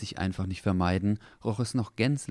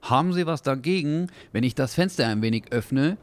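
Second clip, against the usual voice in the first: taxi driver voice; 8 semitones higher, 5 dB louder than usual. Acted. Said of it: "während er versuchte, einen Hustenanfall zu unterdrücken".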